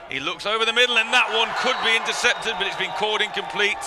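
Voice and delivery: sudden high-pitched burst of commentary; excited play-by-play. Real, not acted. Commentator sees a possible interception, excited live call. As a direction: jump up in pitch as the play turns, then start easing.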